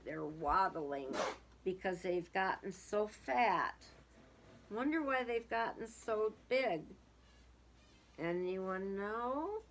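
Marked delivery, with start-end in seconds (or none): none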